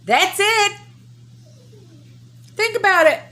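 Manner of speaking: loud, high-pitched outburst; heated shout. Real, not acted. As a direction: yell it out in frustration. Scream until blue in face.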